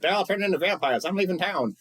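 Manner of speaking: high-pitched, hammed-up Maine accent. Townsman to the main character about vampires, hammed-up Maine accent. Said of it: "That's my main accent".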